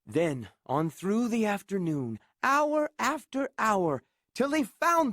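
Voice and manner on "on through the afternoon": ordinary delivery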